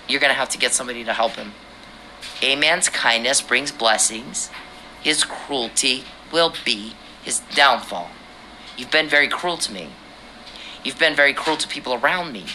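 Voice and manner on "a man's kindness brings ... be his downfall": slow, every word separated for a slow listener; slow, spelled out. Real, not acted. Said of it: "I'll say it and he won't understand".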